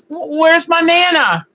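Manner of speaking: put-on slow, high little-girl voice asking for Nana; granddaughter's voice. Grandma imitating granddaughter asking for Nana, high and slow. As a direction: voice the small granddaughter calling for her Nana. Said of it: "Polly hears me on, on the computer and goes".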